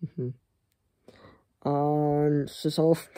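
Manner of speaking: normal talking voice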